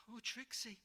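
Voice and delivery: high, scared, halting voice; a boy blurting out his fear; frightened stammer. Acted. George to Trixie, seeing the troll's pot, scared stammer. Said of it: "stammered George".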